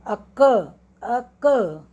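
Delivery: अ क with a short अ and strong, long क; two-beat teacher's demo, क stressed. Shown as a demonstration. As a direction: lean hard on क after a light अ. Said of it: "क पे जोर है".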